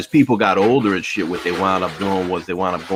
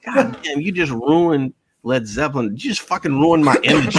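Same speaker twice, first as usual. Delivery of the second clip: high, through laughter; can barely get it out for laughing; mock outrage while laughing; laughing disbelief; 8 semitones higher than usual. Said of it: "And I'm like".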